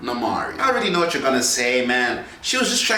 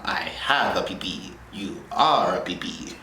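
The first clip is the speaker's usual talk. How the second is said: whisper; 7 semitones lower, 4 dB quieter than usual. Acted. Silly whispered aside.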